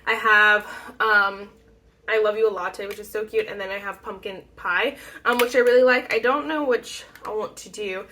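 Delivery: ordinary talk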